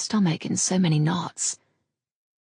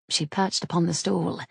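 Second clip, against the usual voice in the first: usual speaking voice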